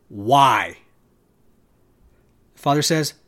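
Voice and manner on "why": a short, low, agitated cry of why; agitated son. A narrator's reading. Son pleading with his father, low and agitated. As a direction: let the son's patience snap on one word. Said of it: "he's getting really agitated".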